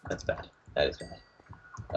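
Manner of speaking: hushed dread whisper. Real, not acted. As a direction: breathe it out in a scared whisper. Dread as night falls.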